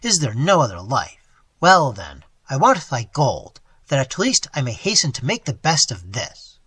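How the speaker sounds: loud, scornful tirade; bitter tirade. Acted. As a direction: sneer and rage at the old man.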